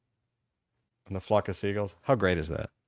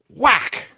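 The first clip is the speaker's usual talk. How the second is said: a sharp, much higher "Whack!" for the board hitting; mock whack sound; 11 semitones higher, 4 dB louder than usual. Telling Keith about the vice principal's paddle, mock whack sound. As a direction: snap out "Whack!" like the blow landing.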